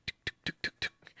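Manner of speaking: whispered knocking sound tuk tuk. Retold or imitated. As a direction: mimic a door knock under the breath. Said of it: "So you go".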